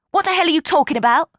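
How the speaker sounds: fast, high, loud outburst; furious shout. Acted. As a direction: blast back at her sister. Gail furious at Ava.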